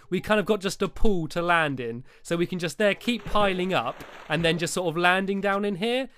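normal talking voice

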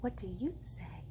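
soft whisper for the ending; hushed reading. Acted. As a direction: settle the story into quiet. Ending book in hush.

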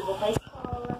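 quiet coaching line for a little one; soft prompt for child. Shown as a demonstration. Mother quietly feeding her daughter the line to repeat, softly. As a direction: say it softly so she can copy. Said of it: "Fala assim".

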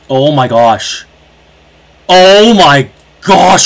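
loud exasperated yelling at teammates; road rage yelling. Real, not acted. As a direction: scream at the useless teammates.

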